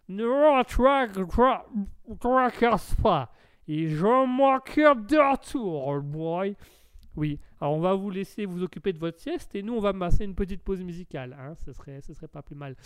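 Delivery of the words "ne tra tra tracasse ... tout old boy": high, slow, stuttering American drawl; drunk American accent. Acted. American reassuring the host, drunken stuttering American accent. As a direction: slur it like a tipsy American. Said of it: "je vois que l'accent est".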